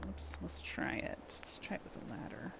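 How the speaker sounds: whispered to herself; hushed aside while working; softly whispered, half to herself. Real, not acted. Muttering to herself while building.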